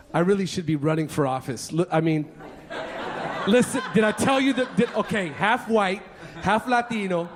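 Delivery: usual speaking voice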